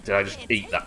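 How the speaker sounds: ordinary talk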